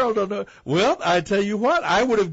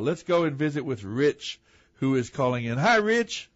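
ordinary talk, nothing marked

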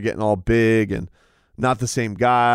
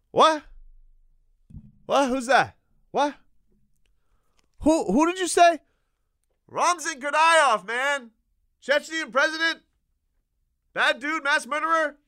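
high, strained mock-confused voice, much higher; Dana pretending deaf; 13 semitones higher than usual. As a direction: play dumb, pitched way up, feigning not hearing. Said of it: "Dana just kept doing this".

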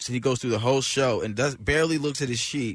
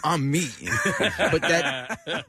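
laughing; 3 semitones higher, much slower than usual. Real, not acted. Laughing at own defiant quote.

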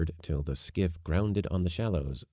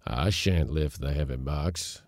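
put-on growling old servant; 2 semitones lower than usual. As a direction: grumble it under protest. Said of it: "growled old Yorpy".

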